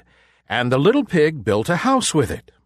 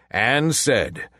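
usual speaking voice

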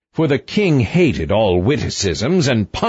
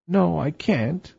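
soft, high, slow refusal; dejected queen; 7 semitones higher, 5 dB quieter than usual. A narrator's reading. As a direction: answer faintly in a high queenly voice.

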